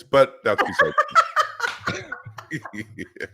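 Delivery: laughing through the words. Real, not acted. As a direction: laugh as the punchline lands. Laughs at own joke.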